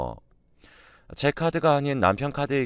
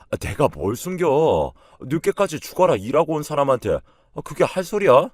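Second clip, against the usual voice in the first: high, raised-voice defensive husband; affronted outburst, pitched up; indignant denial; 6 semitones higher, 5 dB louder than usual. A narrator's reading. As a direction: protest loudly, drawing out the words. Husband's acted indignant denial in quarrel.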